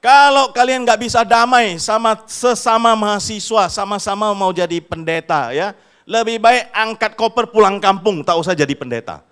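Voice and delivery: loud, high scolding from the pulpit; student preacher rebuking his classmates; stern rebuke. Retold or imitated. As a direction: scold them to pack up and go home. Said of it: "yang khotbah ini bilang ya".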